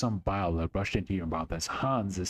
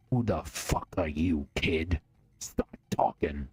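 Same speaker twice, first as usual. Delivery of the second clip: a lower, slow threatening bark; gruff gunman; 4 semitones lower, slower than usual. Acted. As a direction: gunman silences the boy in the backseat, slow menacing growl. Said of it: "He barks abruptly".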